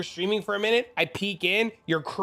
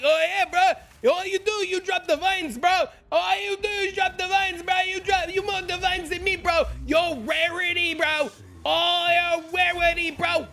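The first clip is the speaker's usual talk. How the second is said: mock bro dude; 11 semitones higher than usual. Streamer imitating a clueless player calling him lucky, high bro voice. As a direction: ham up a clueless bro insisting. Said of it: "And they're like".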